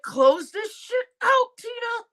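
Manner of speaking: high, slow, pleading demand; mock fed-up chat. Viewers begging Tina to end the show, slow high whine. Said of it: "You guys are like".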